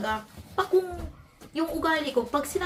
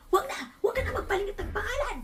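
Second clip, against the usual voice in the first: imitating the wife's raised, higher voice; retold loud protest; 8 semitones higher than usual. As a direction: echo her insisting she drop the name change, pitched high. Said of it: "Ang lakas ng busis". (ginagaya ang asawang pasigaw, mataas ang boses)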